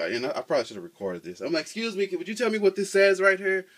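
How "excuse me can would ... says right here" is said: much higher, sugary polite voice asking a favour; overly polite ask. Retold or imitated. Asking passing girls to read the sign, high sweet politeness. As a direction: put on your sweetest asking-a-stranger voice.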